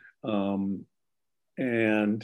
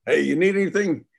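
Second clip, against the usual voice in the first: eager helper; 6 semitones higher, 5 dB louder than usual. Retold or imitated. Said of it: "It's like".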